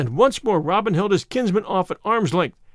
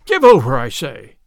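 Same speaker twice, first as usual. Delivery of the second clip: fuming; 4 semitones lower, 8 dB louder than usual. A narrator's reading. Robin rebukes teasing Little John, in a fume. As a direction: cut off the teasing with an angry outburst. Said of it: "quoth Robin in a fume".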